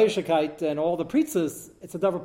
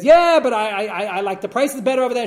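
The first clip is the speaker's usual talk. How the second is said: mother's excuse; 7 semitones higher, 9 dB louder than usual. Retold or imitated. Mother gives her excuses.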